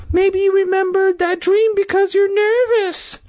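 very high, loud-ish squeaky sister; completely different voice. Acted. Sister suggests to brother a reason for dream, high squeaky voice. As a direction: pitch it way up as the sister. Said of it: "thoughtfully in a completely different voice".

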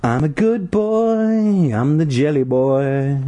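high, slow, drawn-out creepy chant; weird sing-song. Acted. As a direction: sing it sweetly like a creepy child. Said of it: "in a weird sing-song".

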